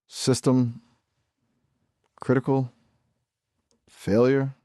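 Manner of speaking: glitching robot. Acted. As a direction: break down like a failing computer. AI voice failing mid-conversation.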